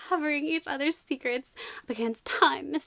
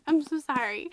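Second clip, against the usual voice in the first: normal talking voice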